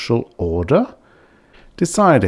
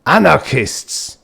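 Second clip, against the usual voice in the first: dredged-up word; 8 semitones higher, 4 dB louder, slower than usual. Acted. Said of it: "he dredged a word up".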